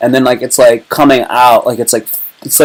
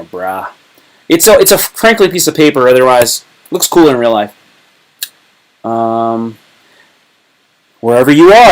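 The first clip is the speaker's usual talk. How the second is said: ordinary delivery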